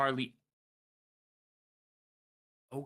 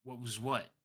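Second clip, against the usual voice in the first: softer, sleepy mumbling man; just woken, dazed and soft; sleepy guard just woken, soft groggy voice; 3 semitones lower, 5 dB quieter than usual. Acted. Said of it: "groggily, you hear a male voice going".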